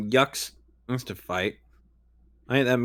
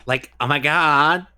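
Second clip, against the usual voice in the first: put-on high, loud Valley Girl gush; 4 semitones higher, 3 dB louder than usual. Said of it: "like a Valley Girl".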